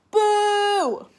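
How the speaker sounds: loud, high mock boo; playful boo. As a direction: shout it like a silly protest sign. Sign read aloud, playful shout.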